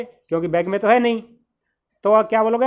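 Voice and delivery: normal talking voice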